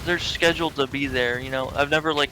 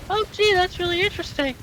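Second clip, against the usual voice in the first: much higher cartoon squirrel; mock cartoon squirrel; Rocky squirrel voice. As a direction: chirp it like a chipper cartoon critter.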